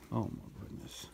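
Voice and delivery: a hushed, whispered oh my goodness; whispered wonder. Real, not acted. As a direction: breathe it out in quiet awe. Reacting to the small dog.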